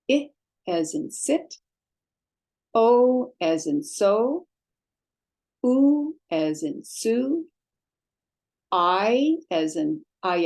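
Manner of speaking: slow, deliberate vowel-by-vowel teaching; measured teacher modelling vowels; slow pronunciation drill. Shown as a demonstration. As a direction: spell out each vowel sound carefully for learners.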